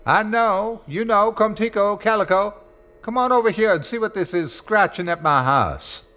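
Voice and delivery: high calling voice; Smitty calling his dogs from the window. Acted. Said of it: "eased to the window and called".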